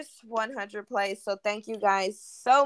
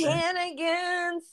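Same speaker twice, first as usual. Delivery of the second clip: mom's voice; 9 semitones higher, slower than usual. Retold or imitated. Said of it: "as my mom says".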